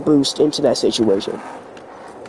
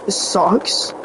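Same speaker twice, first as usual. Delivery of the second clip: slow, voice breaking as if about to cry; voice crack; 2 semitones higher than usual. Real, not acted. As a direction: voice cracks upward on "sucks". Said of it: "That was a voice crack".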